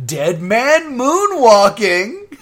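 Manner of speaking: loud, high, drawn-out mock stage call; improv bit announcer. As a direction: belt out the bad comedy bit slowly. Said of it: "it's immediately like".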